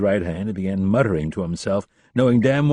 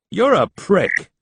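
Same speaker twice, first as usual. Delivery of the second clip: slow, loud, spat-out insult; angry insult; 10 semitones higher, 6 dB louder, slower than usual. A narrator's reading. Rapp snaps at the captain, slow and loud. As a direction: bite each word out.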